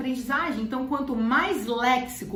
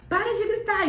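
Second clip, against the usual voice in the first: high, sharp yell of a pupil; mock pupil yelling stop; student yelling; 8 semitones higher, faster than usual. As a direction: throw it out like a kid shouting. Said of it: "se meu aluno fala".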